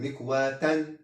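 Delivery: مكواة ending in -an, "mikwatan"; double fatha ending. Shown as a demonstration. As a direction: say مكواة with a final -tan. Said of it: "فتحتان". (مكواة بتنوين الفتح في آخرها)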